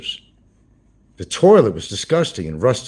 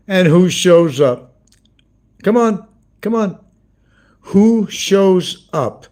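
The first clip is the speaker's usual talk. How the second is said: drawn-out, much higher and louder teasing question; teasing suspense; 9 semitones higher, 7 dB louder, much slower than usual. Real, not acted. Vlogger teasing his viewers about a surprise visitor, drawn out. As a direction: stretch it out like a quiz-show host.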